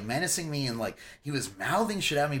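ordinary delivery

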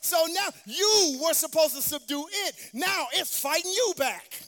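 full-force preaching. Real, not acted. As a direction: preach it hard, building toward the peak. Preacher building to a climax.

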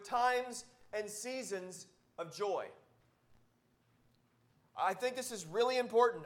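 fervent sermon push; preaching. Acted. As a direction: drive the point home to the congregation. Preaching joy with full force.

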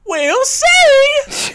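squealed very high and loud, coy and teasing; a giggling, flirty "we'll see"; coyly. Retold or imitated. Host voices the star dodging the tap shoes question, coy squeal. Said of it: "Nell coyly replied".

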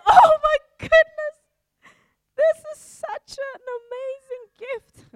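over-the-top delighted gasp; thrilled squeal. Pretend. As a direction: squeal with joy over the gift. Gift receiver overjoyed.

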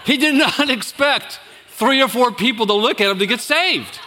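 laughing shout. Real, not acted. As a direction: burst out laughing while telling it. Laughing at the memory of panic.

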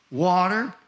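ordinary talk, nothing marked

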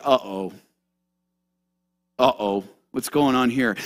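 low, soft uh-oh; mock uneasy realization. Pilate realizing he tortured a divine man, quietly uneasy. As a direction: drop low and quiet, as if trouble dawns. Pilate's dawning fear, acted.

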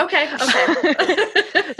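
slow drawn-out teasing. Real, not acted. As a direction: stretch it out mockingly.

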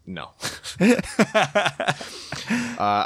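ordinary talk

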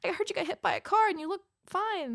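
a nurse gasps, then reassures; surprised nurse. Retold or imitated. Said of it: "she goes".

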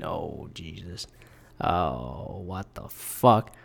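stunned. Real, not acted. Reading shocking allegation.

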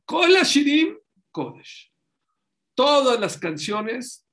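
alto y fuerte, despacio; proclámalo como verdad sagrada; proclamación solemne. Acted. Predicador proclama el versículo. (proclamación solemne y lenta)

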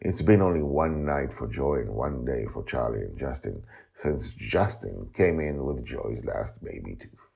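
plain reading voice

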